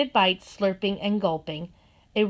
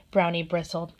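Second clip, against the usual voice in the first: usual speaking voice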